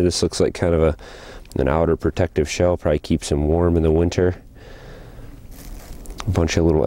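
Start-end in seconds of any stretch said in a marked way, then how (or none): none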